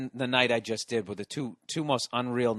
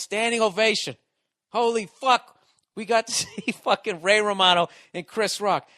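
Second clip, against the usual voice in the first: higher and louder, wound up; excited storytelling; 5 semitones higher, 5 dB louder than usual. Real, not acted. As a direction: tell it like you still can't believe it. Telling the unbelievable night.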